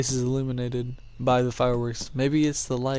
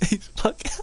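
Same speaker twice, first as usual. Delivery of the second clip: breaking into high giggles; laughing; 12 semitones higher than usual. Real, not acted. Player cracking up at a funny character, laughing. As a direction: laugh through the words.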